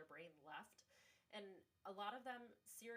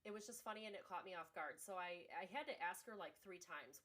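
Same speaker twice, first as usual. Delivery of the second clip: ordinary delivery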